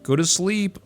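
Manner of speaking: high, sweet mock order to sleep; lightly voiced VTuber telling you to sleep; cute VTuber voice. Imagined VTuber speaking to viewer.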